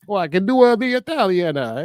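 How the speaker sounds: put-on high, loud caricature Italian accent. Co-host playing the actor offering his fallback, exaggerated Italian accent. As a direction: ham up a mock-Italian accent. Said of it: "borderline offensive Italian impersonation".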